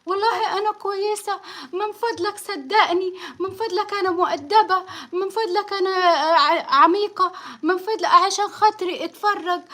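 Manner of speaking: mock pleading. Streamer mocking herself begging critics to watch, high whiny plea. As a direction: beg like a desperate girl proving she's decent. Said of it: "وأنا بقى هقعد آخد".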